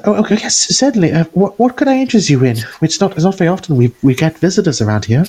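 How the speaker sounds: high, fluttery nervous shopkeeper voice; wiry woman. Acted. Game master voicing the flustered smith's wife. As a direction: play her flustered and eager to please.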